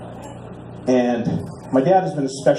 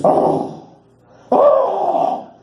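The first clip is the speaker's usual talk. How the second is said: breathy oh rising to a high loud cry; hammed-up moan of ecstasy; mock ecstatic moan; climactic moan; 3 dB louder than usual. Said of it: "And he goes".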